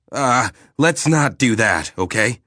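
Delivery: nervous backpedal. Acted. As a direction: hastily talk it out of the idea. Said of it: "Eric backpedaled nervously".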